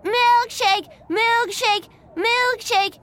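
put-on high, loud childish chant; shouting little boy. A narrator's reading. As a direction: yell each word like a demanding kid. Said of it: "he shouted".

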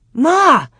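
high, loud calling out to her mother; excited cry. A narrator's reading. Girl calling her mother to see the bare ground, excited cry. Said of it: "she cried".

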